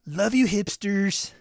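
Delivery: high, loud drawn-out teasing; playful mock affection. Host teasing hipster viewers with playful, sing-out affection. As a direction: call it out high and stretched.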